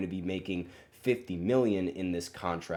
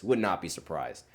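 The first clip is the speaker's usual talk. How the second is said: ordinary talk, nothing marked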